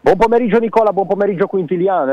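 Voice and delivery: high, loud hello over the crowd; loud greeting. Real, not acted. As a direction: call out the greeting from the stands. Reporter greets from the stadium.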